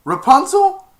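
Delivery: loud, very high call; mock hero's call; calling up the tower. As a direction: shout up to her window. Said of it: "and he said".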